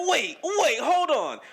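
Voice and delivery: incredulous. Retold or imitated. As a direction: stop everything, you can't believe it. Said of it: "I was like".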